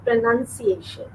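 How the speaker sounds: pronunciation with NUN in the second syllable; careful model of pronunciation, "nun" not "noun"; correct pronunciation. Shown as a demonstration. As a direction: say pronunciation slowly as "pro-nun-ci-a-tion". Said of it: "what I'm saying".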